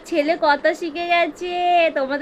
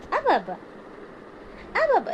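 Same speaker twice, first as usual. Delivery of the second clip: ordinary talk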